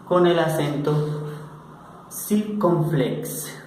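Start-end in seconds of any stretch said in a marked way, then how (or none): 2.1-3.6 circunflex drawn out; slow. Shown as a demonstration. Teacher models the accent name slowly. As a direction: stretch circunflex. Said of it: "se llama circunflex".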